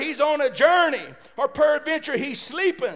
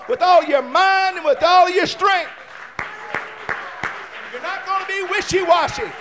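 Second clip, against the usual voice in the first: loud, high, driving sermon cadence; full-force preaching; 5 semitones higher, 6 dB louder than usual. Real, not acted. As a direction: preach it hard and build.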